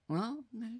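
very soft, resigned shrug of a reply; mild resigned mom. Retold or imitated. Mom zoomed in on, shrugging quietly, soft resigned. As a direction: give in under your breath. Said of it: "kinda go".

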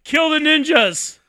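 loud, high, drawn-out command; mock king's yell. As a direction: bellow it slowly like a king. Acting out the yelling king.

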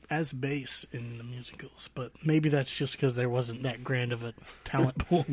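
hushed self-mocking aside; whisper. Real, not acted. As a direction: whisper it sheepishly.

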